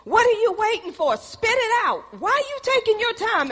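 high, loud, impatient demand to spit it out; impatient urging. Retold or imitated. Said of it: "You be like".

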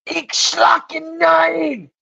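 high, slow, hammed-up shout in fake German; drawn-out mock German yell. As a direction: bellow it like a cartoon dictator.

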